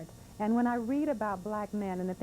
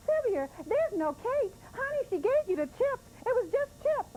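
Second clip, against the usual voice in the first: mother; 3 dB louder than usual. Retold or imitated. Mother voiced correcting child.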